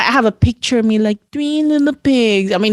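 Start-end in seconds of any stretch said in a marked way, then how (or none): 1.3-2.5 higher, slow sing-song recital; mock child reciting. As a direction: recite it like a shy little kid.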